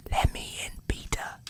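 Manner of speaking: whispered, barely audible fairy voice; a tiny fairy pleading at the door; hushed fairy whisper through the door; soft fairy whisper. Acted. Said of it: "in a lovely, bell-like voice".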